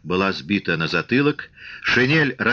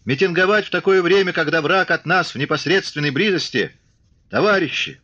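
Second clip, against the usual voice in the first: high-pitched, pressing oratory; urgent appeal; 7 semitones higher than usual. A narrator's reading. Commissar addressing soldiers with the enemy near, urgent high appeal. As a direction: plead with the crowd in a raised pitch.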